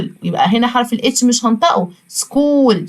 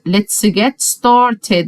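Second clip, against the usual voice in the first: very slow and clear, every word drawn out; slow model phrase; 2 semitones lower, much slower than usual. Shown as a demonstration. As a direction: say it slowly for the class to repeat. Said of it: "انت المفروض بتكرر ورايا". (نطق بطيء وواضح للتكرار)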